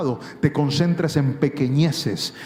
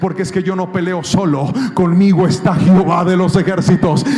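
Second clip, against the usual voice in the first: much louder, building proclamation of faith; a believer shouting back at enemies; triumphant preaching; 10 dB louder than usual. Acted. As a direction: declare it as a victory cry.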